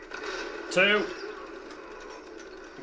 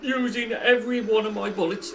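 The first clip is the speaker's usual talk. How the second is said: exasperated; 7 semitones higher, 5 dB louder than usual. Real, not acted. Enemy soaks up bullets.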